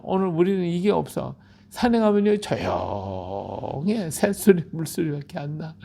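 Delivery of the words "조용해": very slow, low and soft; hushed drawn-out word. Acted. Preacher evokes the quiet mountain, long low hush.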